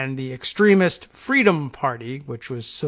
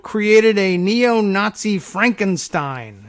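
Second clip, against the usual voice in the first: mock ominous reading; 5 semitones higher, 4 dB louder, slower than usual. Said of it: "you need at least a dramatic horror music".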